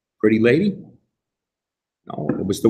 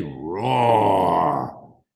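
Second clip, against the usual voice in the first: a lightly voiced mock roar; bear roar; 5 semitones lower than usual. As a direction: growl it like a gentle bear. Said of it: "the bear said".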